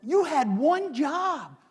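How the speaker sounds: high, slow exasperated scold; mocking scolding priests. Priests scolding the temple guard, high and exasperated. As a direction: scold the guards like they botched it. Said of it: "And they go".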